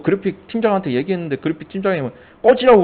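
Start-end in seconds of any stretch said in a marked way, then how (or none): 2.4-2.8 high, loud mock brush-off; mock snarl. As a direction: tell him to get lost in a mocking retelling. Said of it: "욕하고 되게 나빠요". (꺼지라고 하는 말을 흉내 내며 크게 쏘아붙임)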